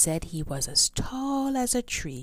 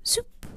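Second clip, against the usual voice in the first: magic zoop sound. Acted. Magic wand zap.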